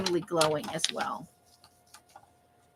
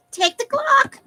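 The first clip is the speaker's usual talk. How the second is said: high squeaky voice; 6 dB louder, slower than usual. Pretend. Reading a clipping in a put-on voice.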